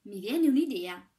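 loud, slow burst of inspiration; excited exclamation. A narrator's reading. Chimney sweep to shepherdess, loud slow eureka. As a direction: announce the idea with a loud slow thrill. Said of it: "esclamò lo spazzacamino".

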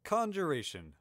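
proud declaration of "Conjuration"; proudly. A narrator's reading. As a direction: state "Conjuration" firmly, high start dropping at the end. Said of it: "Milo said proudly".

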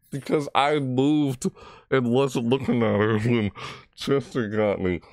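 usual speaking voice

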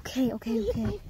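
soft whispered okays; whispering. Real, not acted. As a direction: whisper while hiding. Hiding under the bunk bed.

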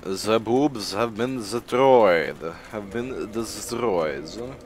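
slow mock Russian accent; hammed-up Russian accent, lilting slowly. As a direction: draw it out in a put-on Russian accent. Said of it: "I switched to a Russian accent".

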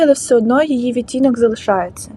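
ordinary delivery

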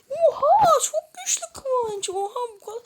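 high loud amazed laugh; shocked laugh. Real, not acted. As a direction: gasp then laugh in awe. Shocked by strength. (şaşkın gülüş)

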